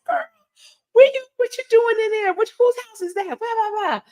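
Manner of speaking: high, squeaky mock mom scolding; high put-on mom voice, not really angry; mother's voice. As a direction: play the surprised mom catching her daughter.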